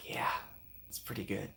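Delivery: unvoiced breathy whisper; hushed aside to the chat. Real, not acted. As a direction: whisper it under your breath.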